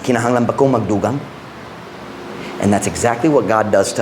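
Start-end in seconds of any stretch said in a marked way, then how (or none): 0.0-1.2 fast rising "kinahanglan ba ko magdugang?"; quick teasing threat. Retold or imitated. Father asking son if he needs more, fast and teasing. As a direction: rattle off the question as a warning.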